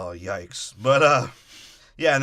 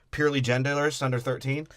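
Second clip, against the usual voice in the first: usual speaking voice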